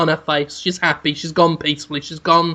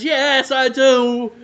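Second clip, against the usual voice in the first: dreamy yes; 8 semitones higher, much slower than usual. Acted. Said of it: "And Matt Borealis says".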